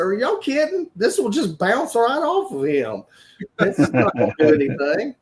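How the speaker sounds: high-pitched disbelief; incredulous protest. Retold or imitated. DJ doubts the girl's little gun, high incredulous voice.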